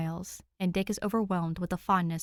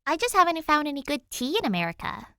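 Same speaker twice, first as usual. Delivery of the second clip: put-on higher, louder girl's voice; eager kid explaining, lightly voiced; lightly voiced young girl; 8 semitones higher, 5 dB louder than usual. Danny telling Alfred about tea, eager girl voice. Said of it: "she explains".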